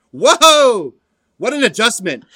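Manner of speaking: high, loud sarcastic whoa; mock amazement. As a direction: fake shock at the obvious. Mock awe at obvious adjustment.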